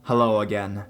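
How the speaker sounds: ordinary delivery, nothing marked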